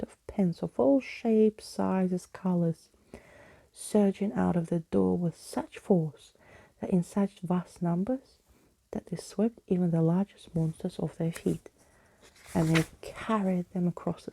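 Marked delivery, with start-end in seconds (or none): none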